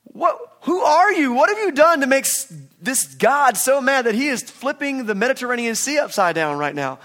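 high, loud panicked demand; panicked sailors. Retold or imitated. Sailors demand answers from Jonah in the storm, panicked high yell.

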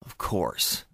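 low sour mutter under the breath. A narrator's reading. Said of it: "Stephen muttered".

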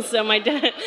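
plain reading voice

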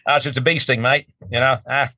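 put-on easygoing Aussie accent, no worries; teasing Australians as tough, in a thick Aussie accent. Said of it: "Aussies are the toughest people".

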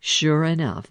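usual speaking voice

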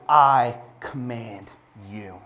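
slow, hushed "I command you" with "I" drawn out; stress on I. Shown as a demonstration. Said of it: "the emphasis is on the word "I".

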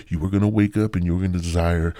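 ordinary talk, nothing marked

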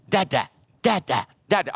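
lightly voiced toddler chattering da; toddler babble. Father recalling his son calling him at nursery, light toddler babble. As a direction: babble like a happy three-year-old. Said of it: "When I pick him up in the nursery".

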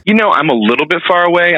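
distant, off-mic sound; far from mic. Real, not acted. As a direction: voice far away from the microphone. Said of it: "Nick, you sound like you're far away".

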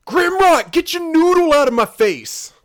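loud, high-pitched movie-quote yell; imitated yelling. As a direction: yell it out like the guy in the film, drawn out toward the end. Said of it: "the guy yelling".

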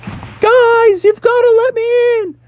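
very high, strained plea to be heard; desperate shout. Acted. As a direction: yell to those inside the plane. Frog hanging on airplane outside.

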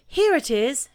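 high, loud burst of excitement; excited cry. A narrator's reading. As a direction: cry out at finding it. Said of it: "cried Christopher Robin excitedly".

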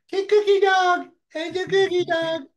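put-on very high baby talk to a dog. Shown as a demonstration. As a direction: squeal sweetly at your dog. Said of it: "that's the way I talk".

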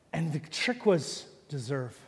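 usual speaking voice

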